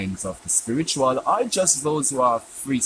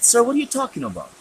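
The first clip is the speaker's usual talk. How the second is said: high, fast, baffled protest; bewildered protest; 12 semitones higher, faster than usual. Retold or imitated. Student baffled by his teacher's warning, high fast protest. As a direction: blurt it out in disbelief.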